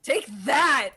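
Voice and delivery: high, forceful battle taunt; triumphant attack cry. Acted. Player smiting the monster, triumphant attack cry. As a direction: punch it out as the blow lands. Player acts the smite landing.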